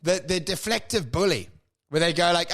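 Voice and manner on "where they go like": ordinary talk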